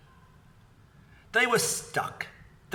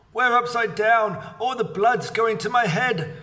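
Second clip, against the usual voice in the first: scream; 7 semitones higher, 5 dB louder than usual. A narrator's reading. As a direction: howl it out in fright. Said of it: "screamed Missus Twit".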